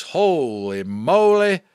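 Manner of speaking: loud, slow, drawn-out holy moly; stunned reader reacting to the story; amazed exclamation. Real, not acted. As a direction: savour the amazement. Reacting to the chapter end.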